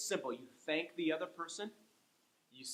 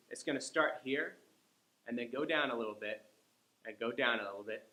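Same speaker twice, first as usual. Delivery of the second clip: walk the voice down step by step; falling tune shown; 3 semitones lower than usual. Shown as a demonstration. Teacher demonstrating the falling tune of a goodbye. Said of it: "you're gonna hear an intonation issue".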